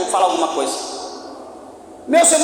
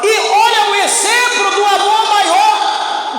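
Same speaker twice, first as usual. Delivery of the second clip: full-force preaching; 6 semitones higher than usual. Acted. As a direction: preach it hard to the congregation.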